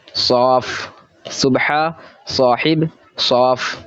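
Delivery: सुबह साहिब सौफ read very slowly, each word apart; slow read-along. Shown as a demonstration. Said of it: "जिस तरीके से मैं पढ़ रहा हूँ". (बहुत धीरे, हर लफ्ज़ अलग करके)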